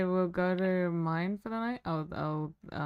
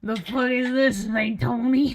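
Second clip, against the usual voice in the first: loud outburst; 7 semitones higher, 10 dB louder than usual. Acted. Character outburst, louder and higher.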